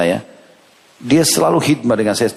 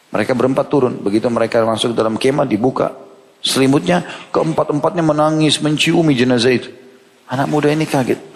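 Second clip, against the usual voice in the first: ordinary talk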